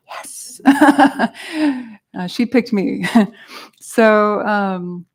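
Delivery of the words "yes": a very soft, voiceless "yes" of private triumph; breath-only little cheer; hushed yes. Retold or imitated. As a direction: whisper it like a secret win. Retold delight at her assigned topic.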